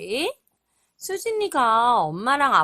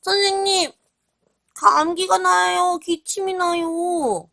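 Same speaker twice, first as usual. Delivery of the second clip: higher pitched sick animal voice; sick little patient; 7 semitones higher, 4 dB louder than usual. Acted. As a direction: play a poorly little raccoon. Sick raccoon speaks to doctor. (아픈 너구리 목소리)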